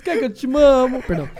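ordinary talk, nothing marked

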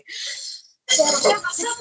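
high, squeaky shocked question; stunned "what happened". Retold or imitated. Makeup artists hearing the sisters must face off, shocked squeak. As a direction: gasp it out like the startled makeup crew. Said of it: "quedaron así como que".

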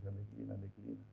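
caricatured Chinese mispronunciation of the mantra; Chinese accent. Acted. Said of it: "Usted nació en China".

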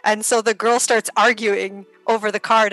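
ordinary talk, nothing marked

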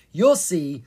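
upbeat and loud; cheerful. A narrator's reading. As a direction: reassure them with a grin in the voice. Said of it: "Zed said cheerfully".

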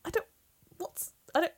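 high, stammering mock distress; in shock. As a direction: start and abandon each question, pleading. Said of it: "in shock".

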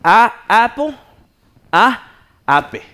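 "apple" as "apo", "ape" as "ah-peh"; uncorrected beginner pronunciation. Retold or imitated. Student answering the teacher's correction, stubbornly Spanish-accented. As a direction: loudly repeat "apple" and "ape" with Spanish vowels. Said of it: "Y yo".

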